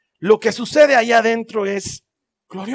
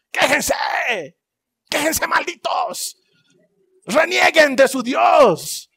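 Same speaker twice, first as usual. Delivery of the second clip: higher, slow yelled taunts; jeering shout; 7 semitones higher, slower than usual. Retold or imitated. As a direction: bark insults at the prisoners. Jailers mocking singing prisoners.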